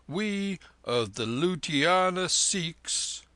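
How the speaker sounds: slow, proud, sounding every word; rolled out sonorously. Acted. Sikh proudly announcing his people, slow and rolling. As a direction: let the name roll out grandly and slowly. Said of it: "he rolled it out sonorously".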